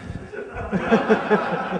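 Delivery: soft breathy laughter; quiet laugh at his own trick. Real, not acted. Speaker telling the Disney phone prank, soft breathy laugh.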